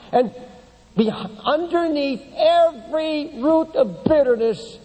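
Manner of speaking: high, emphatic pulpit delivery; full-force preaching. Real, not acted. Preacher warning the congregation about bitterness, high and forceful. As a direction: drive each question home to the congregation.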